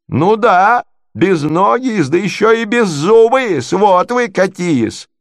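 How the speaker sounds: high, swooping, outraged jeer; jeering insults. Acted. Natalya mocks prince's infirmities, high and jeering. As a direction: hit hard on the opening, then scold on high.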